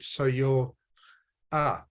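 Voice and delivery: ordinary talk, nothing marked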